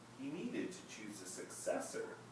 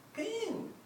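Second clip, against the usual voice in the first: friend calling; 3 dB louder than usual. A narrator's reading. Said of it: "he said".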